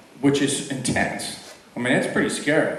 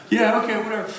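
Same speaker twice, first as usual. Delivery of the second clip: bored shrug; 9 semitones higher, faster than usual. Retold or imitated. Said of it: "You're like".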